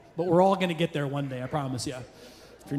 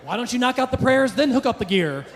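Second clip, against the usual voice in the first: mock pleading; 6 semitones higher than usual. Mock addressing wife as Vader.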